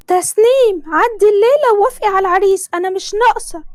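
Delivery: Tasneem's mother. Acted. Mother voiced in story. (صوت أم مصرية عالي ومستعجل)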